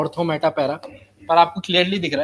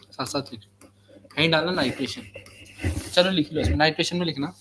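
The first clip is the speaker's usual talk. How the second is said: usual speaking voice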